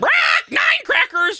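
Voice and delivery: very high, loud, slow squawking parrot. Acted. Parrot shouting back at the man, squawking. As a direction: screech it like a parrot. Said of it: "the parrot just repeats".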